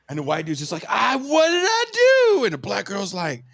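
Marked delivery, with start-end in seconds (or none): 0.9-2.4 bewildered protest. Retold or imitated. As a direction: imitate a wronged man pleading innocence. Punched man protests again.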